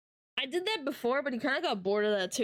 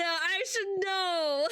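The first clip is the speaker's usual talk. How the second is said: slow laughing I should know; laughing, drawn-out repeat; 6 semitones higher, much slower than usual. Real, not acted. Streamer repeating her own line to chat, slow and laughing. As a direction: stretch I should know out slowly while laughing. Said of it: "What do you mean?".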